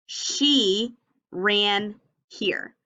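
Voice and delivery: very slow, each word spaced out; slow choral reading. Shown as a demonstration. Teacher reading along with children. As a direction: read along slowly with the class. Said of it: "Read it with me".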